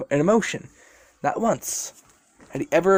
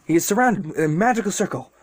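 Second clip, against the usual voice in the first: usual speaking voice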